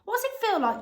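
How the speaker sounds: high eager question; excitedly. A narrator's reading. Grandpa Joe asking Josie eagerly, high and excited. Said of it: "asked Grandpa Joe excitedly".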